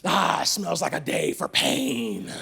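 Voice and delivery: lightly voiced cruel instructor; gleeful drill instructor. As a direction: savour the threat like a sadistic sergeant. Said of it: "It's like".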